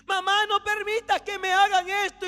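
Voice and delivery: put-on high, loud child's plea; pleading child. Child begging mother to stop the torture, desperate plea.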